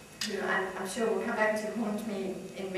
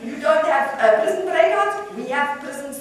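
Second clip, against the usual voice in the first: high, loud, scornful comic rant; outraged caller holding forth, voice climbing; radio caller ranting; 6 semitones higher, 8 dB louder than usual. Acted. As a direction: rant incredulously, punching up each jibe.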